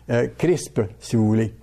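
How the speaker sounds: ordinary talk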